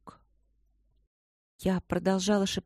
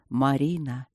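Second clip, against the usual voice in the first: low-pitched, voiced call of the name; a hint of a breath of wind speaking; wind's call; 5 semitones lower than usual. A narrator's reading. Wind calling Marina at night, low and understated. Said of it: "шепнул ветер".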